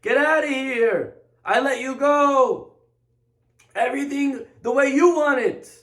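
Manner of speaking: pleading mock scream, stretched at the top of the voice; imitated screaming plea. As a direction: wail it like a panicked ruler begging them gone. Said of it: "And he starts screaming".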